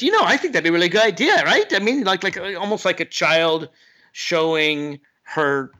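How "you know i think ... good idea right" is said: high, fast pitch for approval; eager approval seeker. Acted. Someone turns to you wanting approval, eager and high. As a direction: act out someone eagerly selling an idea. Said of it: "when someone turns to you and says".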